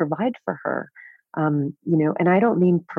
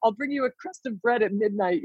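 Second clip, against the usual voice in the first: mock jailer; 6 semitones higher than usual. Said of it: "I was suffocating her, saying".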